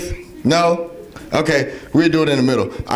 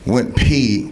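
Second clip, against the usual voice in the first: ordinary talk, nothing marked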